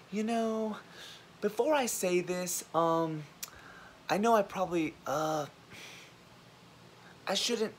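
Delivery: high, halting disclaimer voice; mock hesitancy. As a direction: mimic someone apologizing before speaking. Mimicking people making disclaimers.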